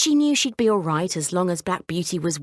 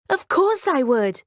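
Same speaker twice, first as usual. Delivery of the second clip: higher, bright eager yes; excited cry; 8 semitones higher than usual. A narrator's reading. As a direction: burst out with delight. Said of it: "cried Lucy".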